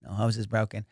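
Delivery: normal talking voice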